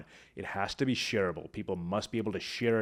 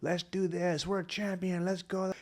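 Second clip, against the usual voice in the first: much higher, rallying; rousing motivational close; 9 semitones higher than usual. Shown as a demonstration. Speaker closing a motivational speech, rallying the crowd. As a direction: fire them up for the finish. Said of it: "motivational close".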